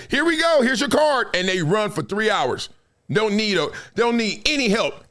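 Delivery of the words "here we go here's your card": cheery handover. Retold or imitated. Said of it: "I can just say".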